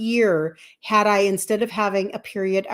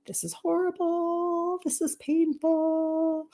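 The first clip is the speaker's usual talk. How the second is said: pained whine; 8 semitones higher, 6 dB quieter, slower than usual. Retold or imitated. Retelling complaint about painful therapy.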